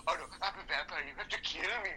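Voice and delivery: lightly hammed-up whine of doom; half-joking melodramatic plea; mock whiny vampire. Imitating the fake vampire begging to be killed, mock whine. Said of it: "it's like".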